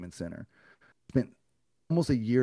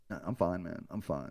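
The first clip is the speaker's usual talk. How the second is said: quiet brush-off; 3 semitones lower, 7 dB quieter than usual. Retold or imitated. Brushing off help, fading.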